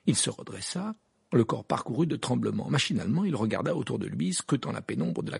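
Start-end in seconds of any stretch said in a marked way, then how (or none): none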